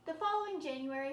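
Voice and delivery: loud, quick month heading; announced month. A narrator's reading. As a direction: call the month out briskly.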